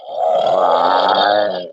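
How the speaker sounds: low growling Bigfoot call. Retold or imitated. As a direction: rumble it like a big ape. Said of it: "And he goes".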